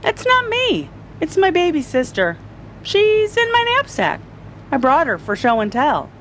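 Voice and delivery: put-on high-pitched kid defending himself; child's squeaky protest, easing at the end; protesting little boy. A narrator's reading. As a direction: plead innocence in a small boy's high voice. Ben explains himself to teacher.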